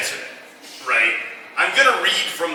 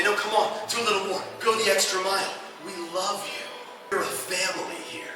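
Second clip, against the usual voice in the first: coaxing boss; 4 semitones higher, 5 dB quieter than usual. Retold or imitated.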